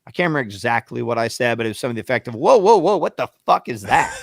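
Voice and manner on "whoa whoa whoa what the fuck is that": high, loud burst of protest; alarmed outburst. Retold or imitated. Rider confronts cabbie over the fare, alarmed shout. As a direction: shout it in disbelief, then ease slightly.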